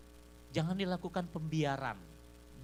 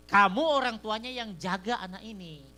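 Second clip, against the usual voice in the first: firm telling-off; 6 semitones higher, 8 dB louder than usual. Retold or imitated. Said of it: "saya bilang begini".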